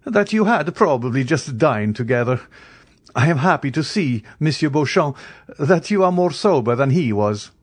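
light mockery without actual laughter; amused, laughing reply. A narrator's reading. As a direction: tease him with mock pleasure.

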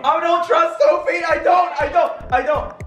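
high, frantic stop, stop; panicked shouting. Real, not acted. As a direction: beg in a panic. Panicking before simulator shock.

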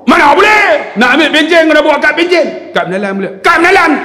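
tengking orang dengan lantang dan tinggi; marah. Retold or imitated. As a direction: lakonan pegawai kaunter yang garang. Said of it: "Dia cakap macam tu". (tengking dengan suara marah)

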